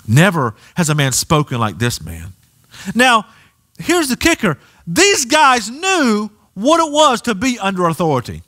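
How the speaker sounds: ordinary talk, nothing marked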